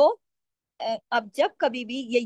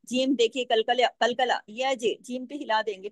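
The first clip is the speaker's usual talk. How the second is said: usual speaking voice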